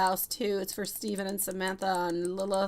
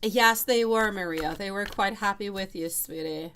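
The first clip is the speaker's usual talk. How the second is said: ordinary talk, nothing marked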